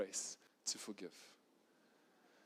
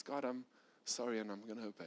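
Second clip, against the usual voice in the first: softer, lower, simple surrender; humble prayer; 5 semitones lower, 8 dB quieter, faster than usual. Acted. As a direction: pray it quietly, just giving in. Voicing humble prayer to God.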